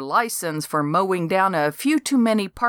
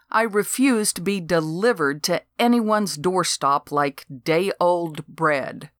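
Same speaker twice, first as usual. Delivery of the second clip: ordinary delivery, nothing marked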